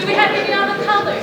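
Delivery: loud, high, quick question; calling out to crowd. Real, not acted. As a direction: call out fast to the crowd. Asks audience for more colors.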